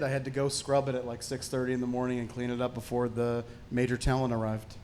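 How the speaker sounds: ordinary talk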